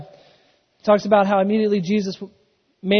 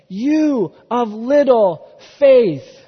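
slow, louder, weighty Jesus rebuke; stern rebuke; 4 semitones higher, 9 dB louder, much slower than usual. Acted. Jesus rebuking sinking Peter, slow emphatic rebuke. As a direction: lean hard on each word like a gentle scolding.

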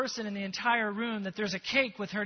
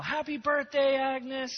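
crowd yelling; 6 semitones higher, slower than usual. Retold or imitated. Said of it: "they yell".

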